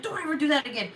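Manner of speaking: high, emotional scolding; mock breakdown. Owner grabbing her runaway cat, emotional scolding. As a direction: plead and scold at once, high-pitched. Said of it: "had, like, a mini breakdown".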